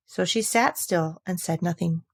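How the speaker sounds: ordinary delivery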